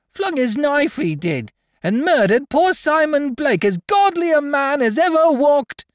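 very high, strident wailing protest; shrill, outraged denunciation; shrill accusation. Acted. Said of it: "he shrilled forth".

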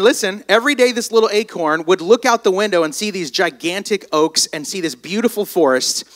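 ordinary delivery

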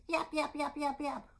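high, quick yapping; little dog yaps. Pretend. Storyteller voicing the little dog, playful high yaps. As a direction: yap like a small excited dog. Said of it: "a little dog goes".

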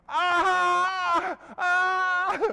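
loud, high drawn-out wail of pain; acted agony scream; pained cry. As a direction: cry out as if hurt, long and high. Hit the deck holding his injury.

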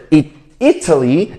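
"Italy" punched on its first syllable; stress on first syllable. Shown as a demonstration. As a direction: offer "Italy" with the opening I stressed. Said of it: "三个音节重音在哪".

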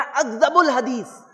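slow, solemn Arabic recitation; slow devotional Arabic chant; reciting hadith. Acted. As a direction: intone the hadith slowly and reverently. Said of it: "نبی صلی اللہ علیہ وسلم نے فرمایا".

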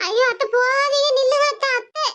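daughter-in-law calling after departing mother-in-law. Acted. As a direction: beg her to stay as she leaves. Daughter-in-law calls after her.

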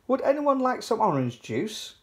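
high sing-out call from indoors. A narrator's reading. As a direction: call the children, pitched well up. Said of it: "called Mummy Pig from the house".

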